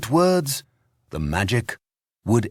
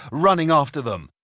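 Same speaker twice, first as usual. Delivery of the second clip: usual speaking voice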